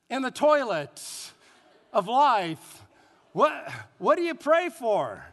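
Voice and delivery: ordinary delivery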